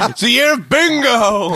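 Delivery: drawn-out high shout. Real, not acted. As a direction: bellow it out slowly. Drunk shouting a catchphrase.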